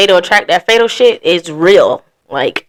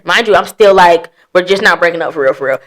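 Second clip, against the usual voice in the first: ordinary talk, nothing marked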